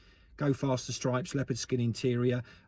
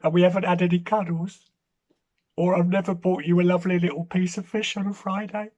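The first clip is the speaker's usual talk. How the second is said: mock-serious breakup voice to the cat; mock breakup speech; 3 semitones higher than usual. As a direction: dump the cat like a jilted lover.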